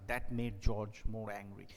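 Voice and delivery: ordinary talk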